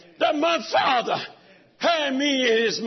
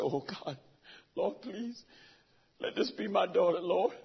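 whispered, much softer pleading to God; hushed prayer; 8 dB quieter than usual. Retold or imitated. Father praying softly in the hospital hallway, hushed plea. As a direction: barely breathe it, begging.

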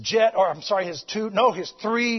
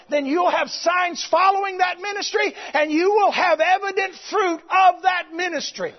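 loud, high preaching shout; fiery revival preacher, shouting; full-force preaching; 9 semitones higher, 5 dB louder than usual. Acted. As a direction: preach it out to the rafters.

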